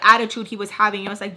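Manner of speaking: normal talking voice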